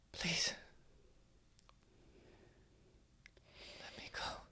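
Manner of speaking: very soft, halting plea; hushed, helpless begging; whispered plea. Acted. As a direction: beg in a faint whisper, with long pauses. Crying captive pleads with attacker.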